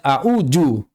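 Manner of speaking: "a'uju" with a j replacing dhal; mistaken jim. Shown as a demonstration. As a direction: say a plain j in "a'uju". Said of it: "banyak baca".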